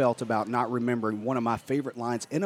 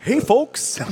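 high, loud mock park guard; lightly imitating a cheerful guard; quoting John Candy; 12 semitones higher, 6 dB louder than usual.

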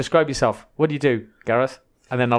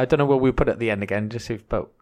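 ordinary talk

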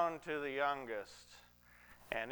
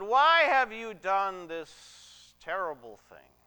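slow, loud, raised rebuke; grave accusation; 5 semitones higher, 8 dB louder, slower than usual. Retold or imitated. Joseph confronting his brothers at the palace, gravely accusing. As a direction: demand an answer, drawing out the retold charge.

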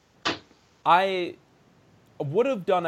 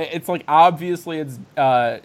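usual speaking voice

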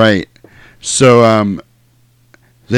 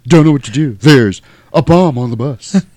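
halting, higher hammed-up delivery; mock starship captain; 6 semitones higher than usual. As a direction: declaim in dramatic chunks with odd pauses. Mock Star Trek captain on the bus.

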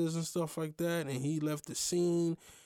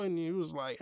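usual speaking voice

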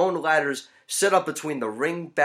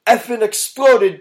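slow, loud, high-pitched yell; re-enacted scream; 9 semitones higher, 9 dB louder than usual. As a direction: punch out each word in a high yell. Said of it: "I screamed".

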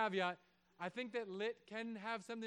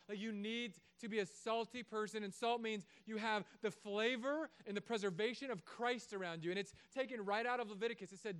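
usual speaking voice

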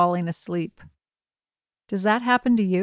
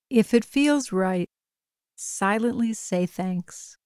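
calm, even bedtime meditation voice; guided meditation. Acted. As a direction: gently guide a listener toward sleep. Leads a bedtime breathing meditation.

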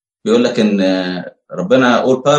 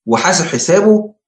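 emphatic retort; 7 semitones higher than usual. Retold or imitated. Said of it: "بيقول لك".

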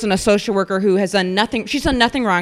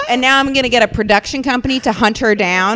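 ordinary delivery

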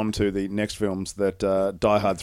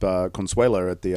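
ordinary talk

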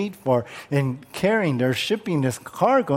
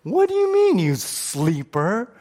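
much higher, incredulous scolding; upset outburst, pitched way up, then slowing on the last words; upset captain; 4 semitones higher than usual. Acted. Captain rousing Jonah asleep in the storm, upset. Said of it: "you could tell he's kind of upset".

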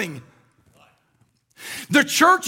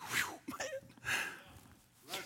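voiceless rush of emotion; breathy overcome whew; 13 semitones higher, 22 dB quieter than usual. Real, not acted. Preacher overcome while telling of Hosea's love. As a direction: let the feeling escape.

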